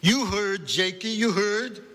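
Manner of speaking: high, emphatic Central European scolding; Central European accent. A narrator's reading. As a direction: drive it home as a Central European grandmother. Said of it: "in a strong Central European accent".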